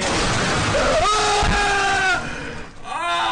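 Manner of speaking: loud, high shrieks; real frightened yelps; startled screams. Real, not acted. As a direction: scream at the scare. Final jump-scare screams.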